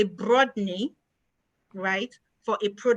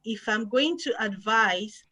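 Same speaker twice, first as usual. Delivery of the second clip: ordinary delivery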